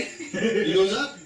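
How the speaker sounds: laughing slow rise; slow, high, drawn out. Acted. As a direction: stretch it upward slowly. Narrating the pixie rising.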